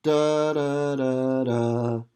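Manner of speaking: light connected da syllables; tongue each "da" lightly, no gaps; "da, da, da" lightly tongued and joined; legato tongued da. Shown as a demonstration. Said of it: "what we call legato tongue it".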